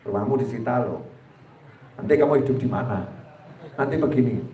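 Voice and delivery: low, soft tempting voice; mock devil whisper. Satan frightens the debtor in low soft voice. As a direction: scare them softly like Satan. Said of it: "setan nakut-nakutin".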